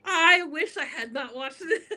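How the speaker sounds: laughing through the regret, high. Retold or imitated. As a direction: giggle out the confession. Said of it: "I was like".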